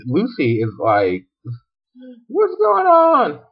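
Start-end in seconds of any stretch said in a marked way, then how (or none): 2.3-3.4 slow, very high squeak; drawn-out high-pitched bewilderment; mock confusion. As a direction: ask in comically clueless alarm. Acted Lucy confused before battle.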